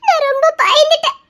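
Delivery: wife laughing off her fear with her husband; laughing through tears. Acted. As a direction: relief breaking into a laugh.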